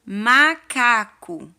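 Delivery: teacher modelling Macaco slowly; slow loud model word. Shown as a demonstration. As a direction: drag out Macaco for the class. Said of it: "Vamos fazer com o primeiro animalzinho".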